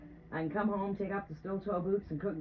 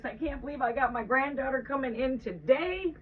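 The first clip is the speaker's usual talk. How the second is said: much higher, bubbling over with joy; giddy excitement; 5 semitones higher, 4 dB quieter than usual. Real, not acted. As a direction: squeal about the granddaughter coming. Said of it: "Oh, am I ever so excited".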